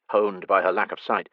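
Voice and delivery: usual speaking voice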